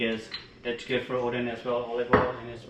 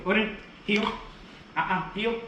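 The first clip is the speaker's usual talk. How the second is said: high heel command, then a firm uh-uh; correcting command; 7 semitones higher, 4 dB quieter than usual. Real, not acted. Trainer correcting Odin during heel work, high firm command. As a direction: correct the dog back into heel, pitched up.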